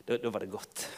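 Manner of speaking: soft, low sigh of relief; gentle hushed relief; [name] relieved. Pretend. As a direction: let the fear melt away quietly. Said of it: "[name], hva følte du da?".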